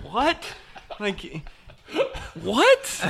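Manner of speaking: very high incredulous squeaks through laughter; can't believe it, laughing; laughing disbelief. Retold or imitated. Caddy retells his stunned reaction to the golfer, laughing. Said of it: "I was like".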